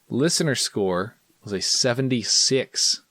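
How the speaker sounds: ordinary talk, nothing marked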